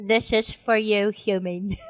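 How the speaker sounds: slow, low put-on British accent. Co-host rereading the AI's message for laughs, in a British accent. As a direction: read it slowly as a mock Brit. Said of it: "Let me read it in a British, ano, accent".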